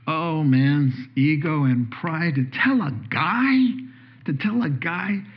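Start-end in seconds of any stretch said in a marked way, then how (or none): none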